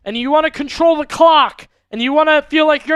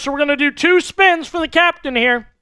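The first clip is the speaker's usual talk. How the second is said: ordinary talk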